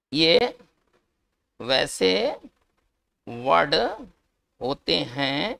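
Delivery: ये, वैसे, वर्ड, होते हैं, each word set apart by long pauses; slow dictation. Shown as a demonstration. Teacher dictating the definition to students, slow word-by-word pauses.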